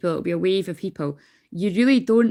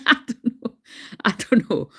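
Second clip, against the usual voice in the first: a laugh breaking in, higher; 4 semitones higher than usual. Real, not acted. Reader laughing off her own guess, higher. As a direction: giggle through I don't know.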